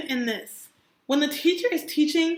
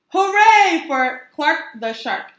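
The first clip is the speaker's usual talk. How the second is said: loud slow cheer; 3 semitones higher, 7 dB louder, slower than usual. Acted. Class cheering for Clark. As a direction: cheer for the hero.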